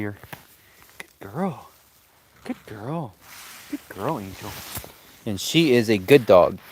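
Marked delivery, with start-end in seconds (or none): 1.0-4.5 much softer, gentle praise for a dog. Real, not acted. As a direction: coo quietly to the dog in the dark.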